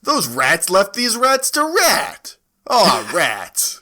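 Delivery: normal talking voice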